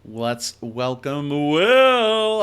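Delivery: slow, loud, drawn-out announcer welcome; game show host. Acted. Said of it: "I said that like a game show".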